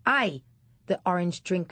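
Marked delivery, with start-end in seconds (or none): 0.0-0.4 higher, louder call-out; sharp call. Acted. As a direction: get the boy's attention. Man calling boy.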